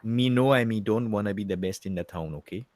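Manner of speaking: hammed-up Jamaican patois; mock Jamaican accent. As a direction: put on a playful Jamaican voice. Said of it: "I know Jamaican really well".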